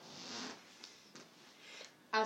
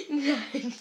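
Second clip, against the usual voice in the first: ordinary talk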